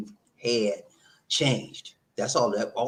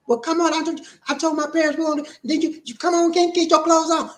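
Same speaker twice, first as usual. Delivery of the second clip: mock nagging girlfriend; 3 dB louder than usual. Girlfriend voiced urging him out.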